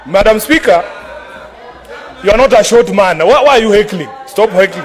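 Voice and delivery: loud, heated protest; shouting. Real, not acted. Protesting heckling in heated debate.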